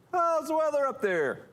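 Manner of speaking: very high hollered question; small creature shouting up in falsetto; Trid calling up. Acted. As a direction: pitch it high and call it upward. Trid calls up to the giant.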